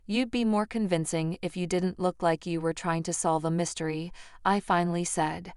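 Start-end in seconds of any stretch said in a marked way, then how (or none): none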